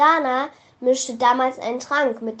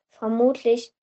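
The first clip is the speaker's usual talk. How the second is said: ordinary delivery, nothing marked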